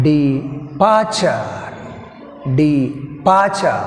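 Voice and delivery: De, a pause, then strong PAR-ture in "Departure"; second-syllable stress. Shown as a demonstration. Teacher splitting the word for learners, "Departure" stressed on PAR. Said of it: "we have stress".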